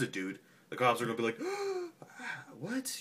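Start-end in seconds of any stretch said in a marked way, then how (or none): 1.4-2.6 sharp high gasp of shock; high mock gasp. Cops hearing about a stabbing, high mock gasp. 2.6-3.0 high squeaky what; mock shock. Cops reacting to the stabber, high mock disbelief.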